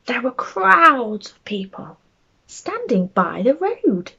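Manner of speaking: bedtime story reading. A narrator's reading. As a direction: read it like a picture book to small children. Reads a bedtime story aloud.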